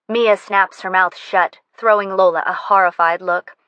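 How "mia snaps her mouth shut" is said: plain reading voice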